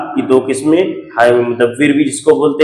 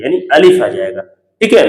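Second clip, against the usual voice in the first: ordinary delivery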